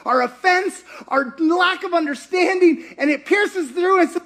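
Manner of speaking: high, loud impassioned sermon; full-force preaching. Real, not acted. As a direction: drive the point home to the congregation.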